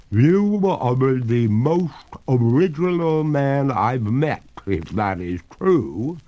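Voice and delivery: a dripping ghost lady, amused and unhurried; water ghost. A narrator's reading. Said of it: "returned the ghost".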